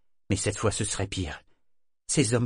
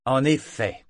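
weighty, louder and higher; emphatic; 4 semitones higher, 5 dB louder, slower than usual. A narrator's reading. Captain answering the sailor, emphatic. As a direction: lean hard on it. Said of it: "d'un ton appuyé".

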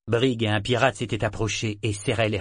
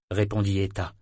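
plain reading voice

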